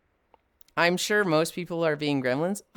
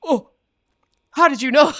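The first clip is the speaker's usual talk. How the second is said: Foss's caught-out reaction; 10 semitones higher, 9 dB louder than usual. Retold or imitated. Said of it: "he did this just like".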